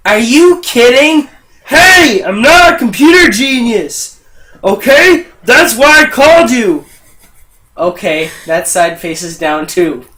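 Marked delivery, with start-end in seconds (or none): 0.1-6.9 loud, high exasperated outburst; indignant customer. Acted. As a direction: blow up at the tech, loud and high. Acted customer loses temper at tech.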